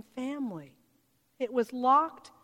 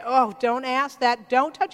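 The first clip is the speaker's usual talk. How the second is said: louder, fussy mock reproach; hammed-up nervous forbidding, louder; mock scolding; 8 dB louder than usual. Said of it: "He doesn't say".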